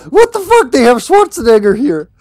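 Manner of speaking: high, loud startled cry; real surprise at a strange enemy; shocked outburst. Real, not acted.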